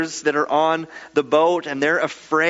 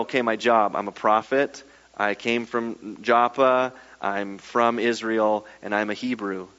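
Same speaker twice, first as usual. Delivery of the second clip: low, subdued run-through of facts; lower-pitched, understated recital; matter-of-fact listing; 6 semitones lower, 3 dB quieter than usual. Acted. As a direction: list personal details low and unremarkably. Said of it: "Jonah doesn't go".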